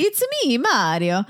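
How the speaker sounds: put-on playful Italian-accented game-hero catchphrase; mock Italian, tapped r in Mario. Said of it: "saying it like an Italian".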